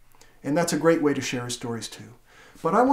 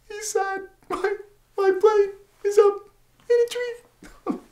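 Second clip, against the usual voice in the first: high squeaky voice of a sad little mouse; slower than usual. Acted. Voicing Mouse for the child, high sad squeak. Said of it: "Is Mouse happy or sad".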